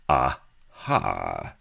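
low, gloating exclaim; triumphant aha. Acted. Avery catches Sheba's slip, low gloat. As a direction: pounce on the slip.

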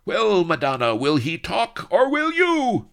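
high, loud, gloating tyrant; fiendish villain. A narrator's reading. Don Lardo rounds on Vanity, fiendish. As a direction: build to a shrieked final question. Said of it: "cackled fiendishly and swung around on Vanity".

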